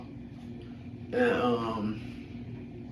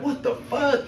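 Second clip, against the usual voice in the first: mock-shocked what the fuck, pitched way up; shocked squeal; 11 semitones higher, slower than usual. Friend retelling the bum's kick, in high shocked disbelief. Bum kicks orange in air.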